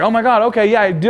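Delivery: put-on giggly girl. Girl admitting her friend is pretty, high giddy voice. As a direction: play the girl caught out, giddy.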